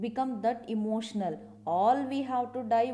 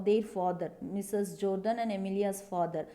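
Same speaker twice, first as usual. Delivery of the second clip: ordinary talk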